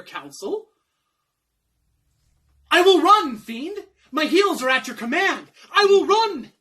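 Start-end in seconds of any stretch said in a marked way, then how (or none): 2.7-3.4 loud, high declaration; triumphant stage shout; resolute cry. Acted. Resolves to obey the fiend. 5.7-6.5 resolute cry. Acted. Final resolve to flee.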